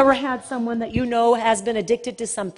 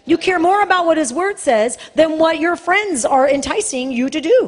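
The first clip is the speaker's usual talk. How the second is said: loud, higher impassioned preaching; fiery preaching; 5 semitones higher, 4 dB louder than usual. Acted. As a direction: thunder the rebuke at the congregation. Preaching at full force.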